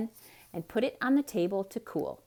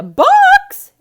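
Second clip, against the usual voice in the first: very high, very loud shriek; exclaimed; 21 dB louder than usual. A narrator's reading. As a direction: scream the word out. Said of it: "exclaimed Missus Rogers".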